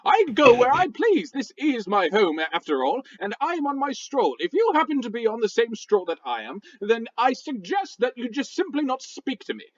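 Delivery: huffy posh British rock voice; indignant British gentleman scolding; a rock with a stuffy British accent; angry British. Acted. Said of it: "angry British sounding talk".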